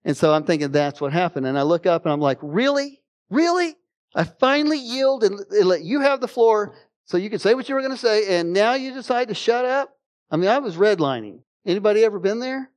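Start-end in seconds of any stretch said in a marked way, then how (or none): 2.4-9.9 much higher, incredulous rant; mock outrage at the sudden silence; fed-up retold complaint; exasperated disbelief. Irritated at silent family.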